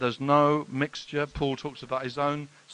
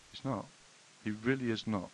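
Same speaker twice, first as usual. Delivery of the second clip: usual speaking voice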